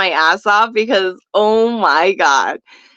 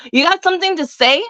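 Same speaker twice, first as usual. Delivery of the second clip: quick, higher-pitched retold challenge; 7 semitones higher, faster than usual. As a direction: throw it out as a quick, rising confrontation. Confronting the man mean mugging her.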